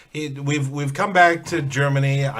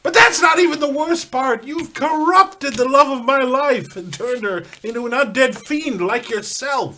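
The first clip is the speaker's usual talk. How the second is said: indignant shout; 7 semitones higher, 5 dB louder than usual. Acted. Raging at the fiend in character.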